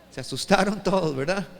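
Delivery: ordinary talk, nothing marked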